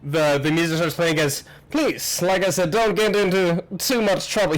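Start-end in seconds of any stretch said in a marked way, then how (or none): 1.7-4.5 high-voiced host repeating his joke; raised, fussy theatrical voice; welcoming host. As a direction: press the little joke on guests.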